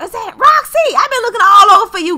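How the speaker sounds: high, loud call across distance. Acted. Friend spots Roxy over there, high loud calling out. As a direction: holler to a friend far off.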